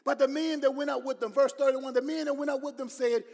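loud, high, driving sermon voice; preaching at full force. Acted. Pastor preaching hard to the congregation about doubters. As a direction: shout it to the congregation.

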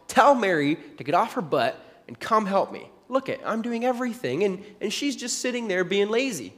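loud, high, exasperated outburst; frustrated complaint. Retold or imitated. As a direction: vent at Jesus like an overworked hostess. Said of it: "she's obviously frustrated with her sister".

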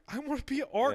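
put-on high, whiny kid voice. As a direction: plead like a sulky boy. Young Hitler whining.

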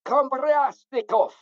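slow, hammed-up Slavic accent; Eastern European accent. Introducing himself to Niegos, in mock Eastern European accent. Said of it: "I do a mean Eastern European accent".

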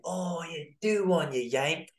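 lightly voiced five-year-old heckling; imitating a cheeky kid. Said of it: "a five-year-old going like this to you".

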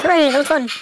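high, loud cheer; mock cheer. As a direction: cheer it out, hammed up. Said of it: "they're like".

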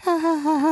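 high, spelled-out canned laughter; fake sitcom laugh track. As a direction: mimic a studio audience laughing. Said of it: "the audience is like".

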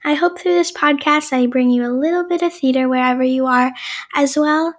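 bright podcast opener; podcast intro. Acted. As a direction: open the show like a host.

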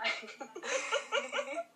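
high burst of laughter. Real, not acted. Laughing at the comparison. (carcajada aguda)

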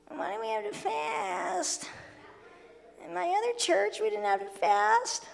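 high, slow whine of a reluctant member; whiny churchgoer voice. Retold or imitated. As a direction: complain like a spoiled church kid. Mocking complaining churchgoer.

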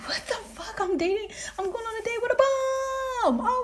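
high, laughing squeal of disbelief; squealed mock horror. Woman realizes she's dating a bum, squealed mock horror.

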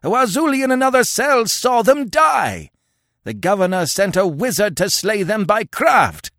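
high, loud, strained shout; shrieked testimony to a crowd; screeching. Acted. Old chief tells how the prisoners died, screeching. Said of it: "he screeched".

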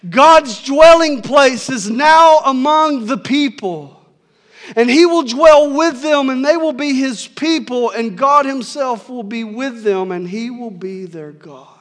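proclaimed high and loud, slowing at the start; loud voice from the throne. A narrator's reading. Reading scripture aloud to the church, a loud heavenly voice. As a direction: announce it like a voice booming from a throne. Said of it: "I heard a loud voice from the throne saying".

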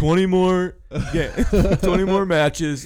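laughing through a quote. Retold or imitated. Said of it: "imitating the gamer".

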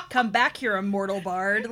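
loud play-acted challenge; mock battle cry. Imagining friend chasing zombie Shakespeare with a weapon, mock shout. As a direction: call after a fleeing foe, hammed up.

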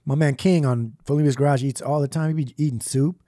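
usual speaking voice

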